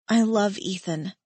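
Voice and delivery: ordinary delivery, nothing marked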